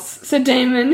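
ordinary delivery

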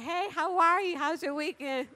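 bright high singsong hello; cheery greeting. Retold or imitated. Cheerful high greeting to a grumpy coworker in the hall.

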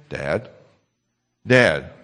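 put-on whiny nagging kid calling; a child tugging for attention again and again; pestering child. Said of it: "five seconds maybe they wait".

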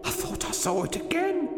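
high, hushed fright; frightened whisper. A narrator's reading. Hurst peering into the passage, frightened whisper. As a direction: hush it in a high, fearful voice. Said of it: "he whispered".

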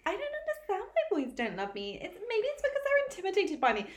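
lightly mock the girl who thinks she's a catch; lightly voiced self-pitying fit girl; mock vain girl. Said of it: "one of those girls that's like".

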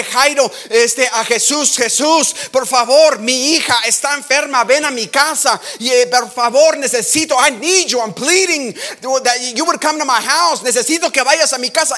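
high, loud desperate begging; pleading father. Acted. Jairus begging Jesus to save his daughter, high desperate pleading. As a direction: plead with everything you have. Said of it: "le dice".

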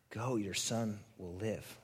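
slow, low and soft; Jesus calmly sending him home; solemn, gentle. Retold or imitated. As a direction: ease it out quietly and slowly. Jesus's words retold solemnly.

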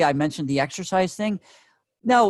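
ordinary delivery

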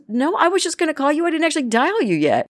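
high, insistent surprised reply; insist, just as astonished; best friend protesting she hadn't dialed yet, surprised; equally amazed. Retold or imitated.

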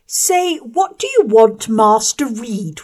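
high, loud mocking correction; slow, bullying boy correcting her; bullying schoolboy. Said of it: "was the answer".

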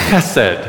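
chesed with a throaty ch, then sed; Hebrew pronunciation. Shown as a demonstration. Pastor leading the congregation to repeat chesed, throaty ch. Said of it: "the first syllable is in the back of your throat".

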